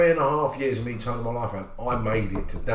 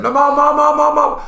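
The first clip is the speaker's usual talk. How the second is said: much higher, louder, urgent repeated calling; excited friend; 10 dB louder than usual. Retold or imitated. Friend on the phone, urging Marvin to believe him, excited. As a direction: insist over and over to be heard.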